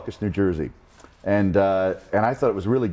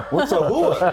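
puzzled classmates; 9 semitones higher, 4 dB louder, slower than usual. Retold or imitated. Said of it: "People were like".

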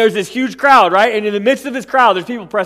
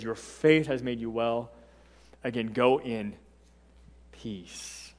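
very soft, fading to a whisper; tender hush; 9 semitones lower, 13 dB quieter, slower than usual. Acted. Jesus sending the woman away in peace, whispered. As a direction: let the blessing fall to a breath. Jesus blesses her again.

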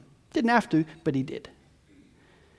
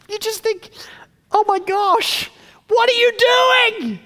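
high, rising to a slow shout; oh my gosh, louder and higher to a yell; exasperated outburst; 11 semitones higher, 4 dB louder, slower than usual. Real, not acted. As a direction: cry out in disbelief at their folly. Preacher's exasperated reaction.

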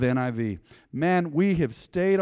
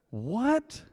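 high rising, baffled question; puzzled "what", pitch shooting up; mock bafflement; 9 semitones higher, 4 dB quieter than usual. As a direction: act totally lost by the fancy words. Mock bafflement at archaic words.

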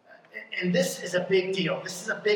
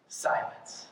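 normal talking voice

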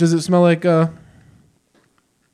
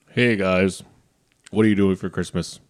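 lower, calmer retake of the intro; low host greeting; 8 semitones lower, 4 dB quieter than usual. Shown as a demonstration. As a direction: start the show again, lower. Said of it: "let me take that again".

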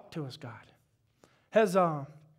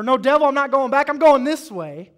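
loud, high defiance at the devil; defiant shout; 5 semitones higher, 10 dB louder than usual. Pretend. Believer shouting down the devil, loud and defiant. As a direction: tell the devil no with full force.

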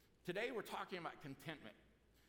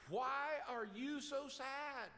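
much higher, slow, bewildered question; baffled friend; 8 semitones higher, 4 dB louder, much slower than usual. Acted. Friend baffled by the sad man, asking slowly and much higher. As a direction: ask it slowly, utterly baffled.

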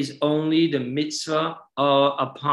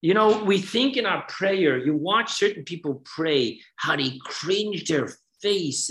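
ordinary delivery

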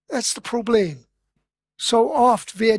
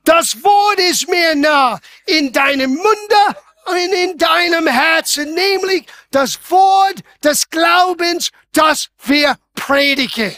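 loud, higher proclamation of scripture; full-force preaching; 4 semitones higher, 4 dB louder than usual. Acted. Preacher quoting scripture to the church, shouting. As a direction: thunder the verse over the congregation.

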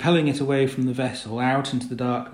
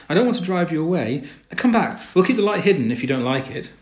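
raised, higher voice calling across water; 7 semitones higher, 7 dB louder than usual. A narrator's reading. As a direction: call them back, loud and urgent. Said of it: "sang out Will".

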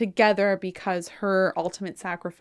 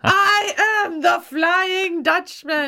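slow, loud, high mock proclamation; grand proclamation; 10 semitones higher, 6 dB louder, slower than usual. Dutchman revealing who he is, mock grandiose. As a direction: declaim it with hammed-up grandeur. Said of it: "And he's like".

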